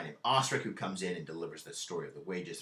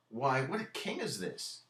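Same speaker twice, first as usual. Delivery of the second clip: higher, slow, stunned question; shocked Horatio; 6 semitones higher than usual. Retold or imitated. Horatio reacting to the killings, a touch shocked. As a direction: let the shock land on king. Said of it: "Horatio's kind of shocked".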